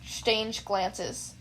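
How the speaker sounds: ordinary delivery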